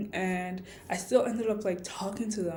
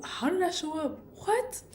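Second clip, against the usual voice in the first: surprised; 9 semitones higher than usual. Real, not acted. Startled by something while recording.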